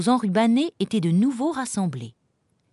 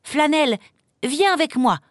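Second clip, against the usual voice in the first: higher, urgent command; giving orders; 8 semitones higher, 4 dB louder than usual. A narrator's reading. Mistress ordering her dog to follow, commanding. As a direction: summon your dog briskly. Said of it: "ordonna-t-elle".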